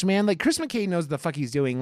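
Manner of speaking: ordinary talk, nothing marked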